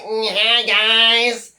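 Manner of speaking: slow, dragging, dull put-on voice of a bored vlogger; mock him by sounding as dreary as possible; sleepy, droning parody greeting; mocking Stun Gun Paddy. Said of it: "Another fucking bore".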